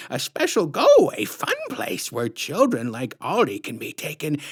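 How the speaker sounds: high, slow sugary put-on voice, far above the narrator; sickly sweet menace, drawn out; syrupy sinister clown woman introducing herself; creepy clown lady. A narrator's reading.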